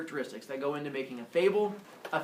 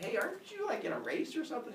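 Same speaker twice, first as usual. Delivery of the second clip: put-on high-pitched squirrel girl voice; put-on high cheerleader question; 9 semitones higher, 4 dB quieter than usual. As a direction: ask it in a light, high cartoon voice.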